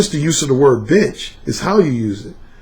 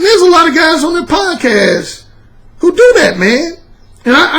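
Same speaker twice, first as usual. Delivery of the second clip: heated rant; 10 semitones higher than usual. Real, not acted. Ranting against podcast guys.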